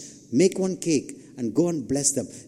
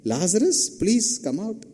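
higher-pitched, hammed-up polite request; mock polite pleading; 5 semitones higher than usual. Preacher parodying a timid call to Lazarus, mock polite pleading. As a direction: plead politely in jest, pitch lifting on please.

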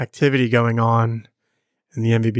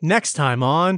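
hyped outro call; 3 semitones higher, slower than usual. Acted. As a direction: sell the outro with energy. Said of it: "Are you guys not gonna participate?".

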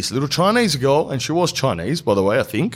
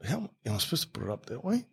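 muttered aside; 13 dB quieter, much faster than usual. Real, not acted.

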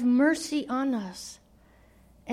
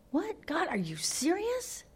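hushed, stunned "what?" to God; mild shocked disbelief, half under the breath; 3 semitones higher, 7 dB quieter than usual. Acted. Habakkuk stunned by God's plan.

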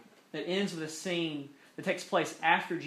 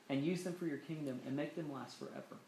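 much softer, hushed plea; quiet prayer; 2 semitones lower, 9 dB quieter than usual. Shown as a demonstration. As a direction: pray it almost under the breath. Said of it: "if you're a big shot politician".

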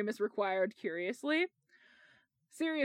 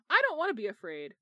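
ordinary delivery

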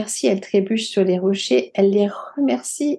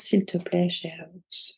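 much softer, fading almost to a breath; calm soft plea; 8 dB quieter, slower than usual. A narrator's reading. Woman, now calm, quietly asking the bear for one hair. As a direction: ask the bear gently, no longer afraid. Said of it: "Et elle dit à l'ours".

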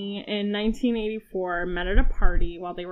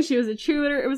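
normal talking voice

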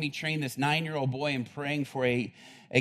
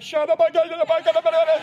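frantic shout; 13 semitones higher, 9 dB louder, faster than usual. Retold or imitated. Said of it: "And he's like".

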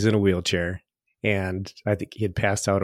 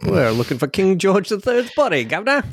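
hammed-up British accent, pitched higher; over-the-top British accent, higher voice; mock British accent; 8 semitones higher than usual. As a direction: play a cheeky Londoner asking for a king's body. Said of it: "I don't speak British, but my friend does".

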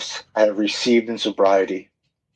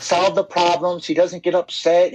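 higher-pitched quote of an amazed wife; imitating wife; 9 semitones higher than usual. Husband quoting wife on his change, imitating her higher. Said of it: "She's like".

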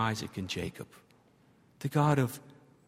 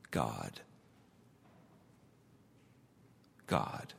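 much lower and softer, reverent; hushed solemn repetition; 10 dB quieter than usual. Real, not acted. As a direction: let the name sink in, hushed. Solemn repeated name, very low.

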